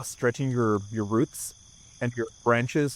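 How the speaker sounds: normal talking voice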